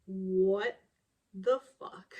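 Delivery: loud, stunned what the fuck; shocked what the fuck. Retold or imitated. Genevieve reacting to the accusation, loud and shocked. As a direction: blurt it out in shock. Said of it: "she's just like".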